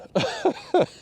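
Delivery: a sudden high giggle; high burst of laughter. Real, not acted. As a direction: fish splashing the fisherman, high giggle. Fish splashing him.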